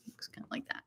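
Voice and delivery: breathy aside whisper. Real, not acted. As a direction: drop to a voiceless whisper. Aside after reading code.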